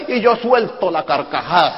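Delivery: usual speaking voice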